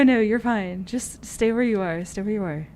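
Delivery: usual speaking voice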